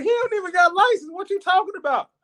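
high squeak of disbelief; arguing with the inner voice, incredulous. Retold or imitated. Repeating disbelief, much higher.

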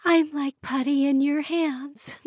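high flirty tipsy wife voice; giddy. Acted. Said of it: "She giggled".

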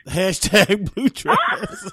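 slow amused chuckle; laughing. Real, not acted. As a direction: laugh through the words. Teasing repeat of joke.

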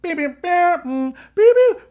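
high-pitched electronic beeps; imitated toy noises. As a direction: squeak out slow beeps like a gadget. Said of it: "you press it and it goes".

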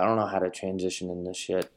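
usual speaking voice

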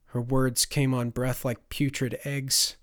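ordinary delivery, nothing marked

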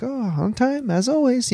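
high-pitched imitation of an Italian accent. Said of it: "in that wonderful Italian accent of his".

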